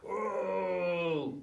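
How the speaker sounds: hat maker huffs loudly at the monkeys; annoyed grunt. Acted. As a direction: shake a fist and let it out. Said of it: "and he goes".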